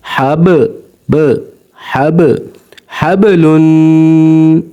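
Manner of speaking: हब with the ब bouncing and echoing; qalqala on ba. Shown as a demonstration. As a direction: let the ब in हबलुन shake and ring. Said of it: "इसकी आवाज़ हिलती रहेगी". (हबलुन में ब की आवाज़ हिलती रहे)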